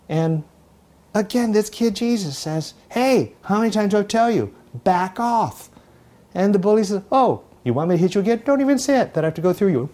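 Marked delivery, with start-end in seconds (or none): 2.9-5.7 exasperated call-out. Acted. Jesus confronts bullies a third time. 7.1-9.9 taunting bully. Acted. Bully taunts the new kid before hitting him, mocking.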